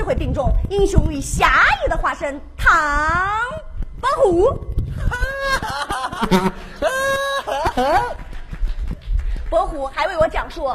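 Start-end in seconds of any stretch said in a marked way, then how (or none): 4.6-9.4 high silly laugh. Acted. Laugh after naming Tang Bohu.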